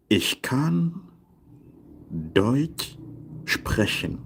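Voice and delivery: long pauses around Deutsch, sprechen set apart; slow word-by-word model. Shown as a demonstration. Teacher translating I can speak German, slow modelling. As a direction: lay the sentence out piece by piece for learners.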